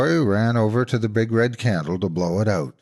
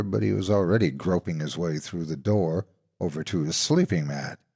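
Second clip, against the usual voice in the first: usual speaking voice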